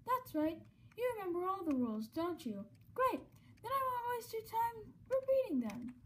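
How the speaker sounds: sassy game show host; showman host voice. Acted. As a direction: tease like a showy host. Voicing Mettaton dialogue.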